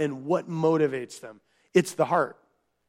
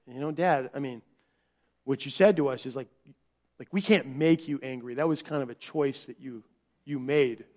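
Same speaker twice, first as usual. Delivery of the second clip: lightly voiced child, lower and softer; subdued, understated correction from a kid; child to dad; 6 semitones lower, 7 dB quieter than usual. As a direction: gently point it out to dad, quietly. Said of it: "one of my children said to me".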